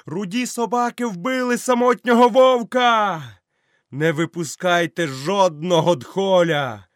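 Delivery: high, loud, slow vengeful yell; vengeful shout at the pack; furious shout. Acted. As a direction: scream for revenge over the fight. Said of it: "кричав Мауглі".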